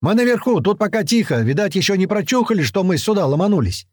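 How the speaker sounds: high-pitched call from above; calling down to the others; calling back. A narrator's reading. Answering a shouted call from below.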